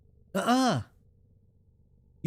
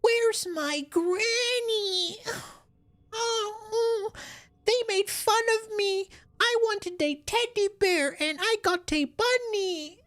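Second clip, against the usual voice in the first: put-on very high, slow, sobbing kid voice; whiny crying child. Mocking the bunny quest character, high and sobbing. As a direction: wail it like a bullied little kid.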